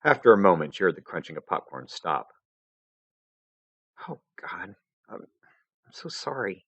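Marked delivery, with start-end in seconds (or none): 4.1-6.6 quiet, hesitant and tearful; holding back tears; tearful apology. Acted. Vriska breaking down.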